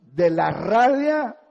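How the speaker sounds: preacher loudly testifying how his anger turned to laughter; emphatic exclaim. Real, not acted. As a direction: proclaim it to the congregation. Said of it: "de la rabia pasé a la risa".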